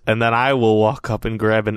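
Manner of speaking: ordinary talk, nothing marked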